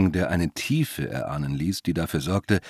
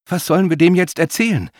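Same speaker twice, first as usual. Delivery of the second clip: high, louder anxious question; agitated; 10 semitones higher, 5 dB louder than usual. A narrator's reading. Woman asks companion what to tell him, agitated. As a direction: ask it all worked up. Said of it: "fragte Mila mit ungewohnter Aufregung".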